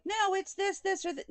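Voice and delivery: high, snippy mock objection; mocking know-it-all. Mocking viewers who would correct her, high and snippy. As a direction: ape a smug commenter in a high voice. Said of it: "don't come at me and be like".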